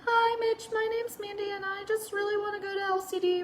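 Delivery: high, small, mock-crying voice; squeaky, soft imitation of crying; imitated tearful embarrassment. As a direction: act out introducing yourself through tears. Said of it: "I literally started crying. I was just, like, so embarrassed".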